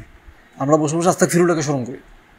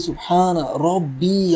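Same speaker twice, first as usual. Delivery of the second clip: slow recitation; much slower than usual. Shown as a demonstration. Quoting the prostration prayer.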